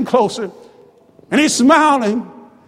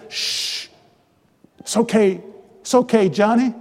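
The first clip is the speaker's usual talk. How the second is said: softly hushing, lower and quieter; soothing father; 4 semitones lower, 4 dB quieter than usual. Acted. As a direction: hush and comfort a returning child. Father soothing his repentant son.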